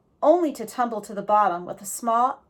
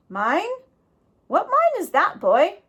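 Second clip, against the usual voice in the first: higher, louder folksy outlaw; old road agent; 9 semitones higher, 4 dB louder than usual. A narrator's reading. Reformed road agent chatting with Jack, folksy and amused.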